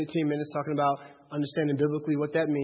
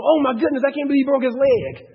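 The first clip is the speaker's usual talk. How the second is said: mock flustered God; 8 semitones higher, 8 dB louder than usual. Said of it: "God's going".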